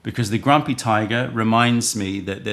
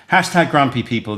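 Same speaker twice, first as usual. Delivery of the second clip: hashtag grumpy called out louder and higher; calling out; 5 semitones higher, 9 dB louder than usual. Real, not acted. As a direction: announce it to the whole chat. Announcing giveaway keyword.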